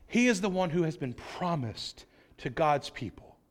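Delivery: usual speaking voice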